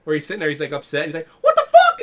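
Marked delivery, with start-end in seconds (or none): 1.4-2.0 very high, loud cartoon outburst; mock outraged squeak. Mascot upset before beating a kid, squeaky mock outrage. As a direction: squeal it in put-on fury.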